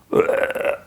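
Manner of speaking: a breathless, pained groan. Retold or imitated. Impaled man groans after landing. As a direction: let out a hurt, airy groan. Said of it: "he, like, groans".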